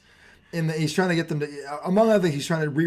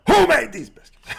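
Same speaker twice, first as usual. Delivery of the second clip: raised, laughing mock interrogation; mocking loud demand; 9 semitones higher, 9 dB louder than usual. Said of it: "I didn't say it like that".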